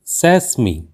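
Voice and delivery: सेसमी said as "SES-mee"; correct pronunciation. Shown as a demonstration. Teacher gives learners the correct word, carefully pronounced. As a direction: say सेसमी with short first e, long final ee. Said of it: "इस शब्द का सही उच्चारण होता है".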